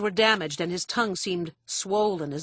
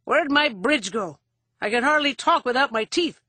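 loud, high, querulous complaint; cranky injured drunk; 7 semitones higher, 6 dB louder than usual. A narrator's reading. Father missing his dental bridge, cranky. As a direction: complain loudly, pitched up and put out.

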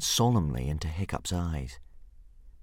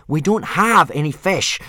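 louder, slow, strained; through gritted teeth; 9 semitones higher, 9 dB louder than usual. Acted. Hiccup losing patience with his dragon, through gritted teeth. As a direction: force it out through clenched teeth. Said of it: "from between gritted teeth".

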